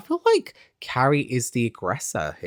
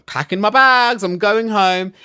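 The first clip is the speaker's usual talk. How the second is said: loud, higher retold huff; mock storming off; 6 semitones higher, 5 dB louder than usual. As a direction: act out an indignant exit. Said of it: "storms off".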